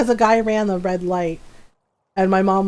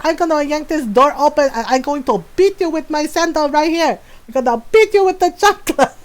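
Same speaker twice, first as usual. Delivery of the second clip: mock threat; 5 semitones higher than usual. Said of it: "She's like".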